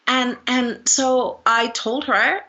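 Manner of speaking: normal talking voice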